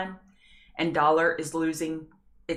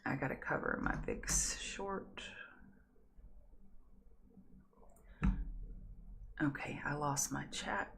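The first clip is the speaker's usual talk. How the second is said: whispered under her breath, off to the side; muttered to herself mid-trade, barely voiced; whispered aside; 2 semitones lower, 13 dB quieter than usual. Real, not acted. Streamer whispering an aside to herself while fixing a trade.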